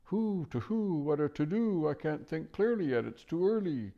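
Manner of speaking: high owl voice, mumbled to itself; muttering owl. Acted. Owl muttering to itself while leading the way. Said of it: "muttering to itself".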